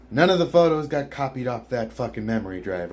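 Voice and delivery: usual speaking voice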